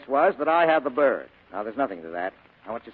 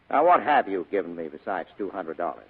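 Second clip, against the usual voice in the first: ordinary delivery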